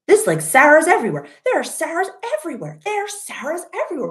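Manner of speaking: quoting her own baffled thoughts on the bus; high excited disbelief. Retold or imitated. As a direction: rattle it off in amazed high pitch. Retold inner thought about Portland.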